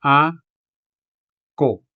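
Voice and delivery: A-ko split into two syllables, a clear break after A; syllabicated ako. Shown as a demonstration. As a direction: say A, pause, then ko. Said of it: "Now let's syllabicate this word".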